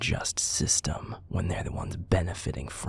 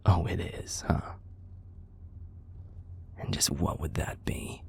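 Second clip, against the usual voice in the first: ordinary talk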